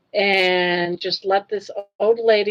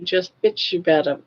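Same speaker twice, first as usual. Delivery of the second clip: ordinary talk, nothing marked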